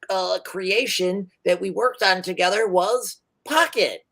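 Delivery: high, eager inventor voice; high chatty tinkerer; rambling gnome inventor. Acted. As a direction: tell his backstory fondly. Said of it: "He's like".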